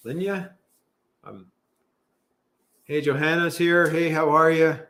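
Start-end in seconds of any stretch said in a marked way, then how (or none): none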